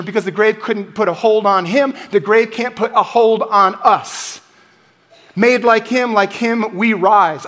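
preaching at full force. Acted. Preacher lifting the congregation, building to a shout. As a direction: preach it, climbing to the peak.

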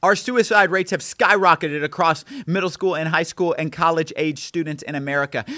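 ordinary talk

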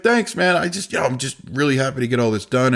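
chirpy upbeat impression of the worker; happy technician. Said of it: "He's like".

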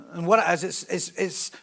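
ordinary talk

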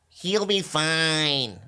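imitated Mitzi. Mitzi answering Rogan's complaint, slow high drawl. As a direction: draw every word out in a put-on voice.

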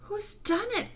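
put-on very high, anguished girl's voice; high-pitched horrified question; distressed cry. A narrator's reading. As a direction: cry out in dismay. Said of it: "cried Susan".

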